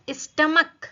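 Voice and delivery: स्टमक said loud and high, ending in a crisp K; hard K ending. Shown as a demonstration. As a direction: pronounce the CH in स्टमक as K. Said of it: "यहाँ पर हम स्टमच नहीं बोलेंगे".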